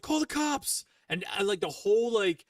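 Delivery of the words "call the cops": calling out. Retold or imitated. Bystander calling out during fight.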